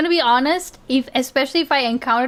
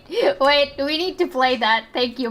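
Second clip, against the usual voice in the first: normal talking voice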